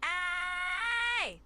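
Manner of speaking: "Ay" held long and high; "Ay" stretched on one high note; maximum emphasis. Shown as a demonstration. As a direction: push "Ay" out as a high, sustained cry. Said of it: "as much emphasis into it".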